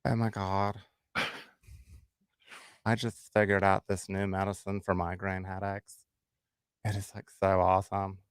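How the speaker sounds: lightly done reality-star voice; Khloe Kardashian impression. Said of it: "Khloe Kardashian".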